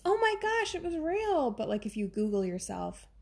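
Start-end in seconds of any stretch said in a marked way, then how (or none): 0.0-1.5 much higher, louder, hammed-up astonishment; mock amazed gasp. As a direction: play the gullible client blown away. Fooled client amazed by fake reading.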